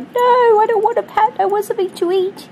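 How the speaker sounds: very high, loud, whiny dog refusing a pat; Sprinkles' inner voice. Retold or imitated. Owner voicing Sprinkles wanting food, high and whiny. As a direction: squeal the refusal like a spoilt pup. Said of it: "She's like".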